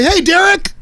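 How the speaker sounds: high, eager call to a friend; excited hailing. Acted. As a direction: hail someone in a hammed-up greeting. Acted greeting, calling wrong name.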